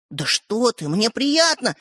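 ordinary delivery, nothing marked

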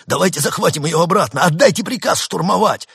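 high, loud, eager appeal; urgent urging. A narrator's reading. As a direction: push the commander to act now. Soldier urges an attack.

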